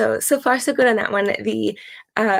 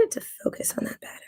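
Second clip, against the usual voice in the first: whispering; 13 dB quieter, much faster than usual. Real, not acted. Fiddling with camera focus.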